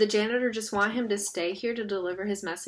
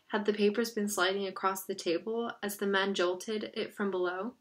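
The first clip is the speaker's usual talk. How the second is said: plain reading voice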